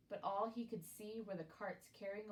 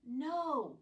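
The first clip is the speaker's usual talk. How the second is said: sharp raised cry of no; cry of alarm; 6 semitones higher, 6 dB louder than usual. A narrator's reading. James crying out to stop Henry, alarmed shout. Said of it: "cried James".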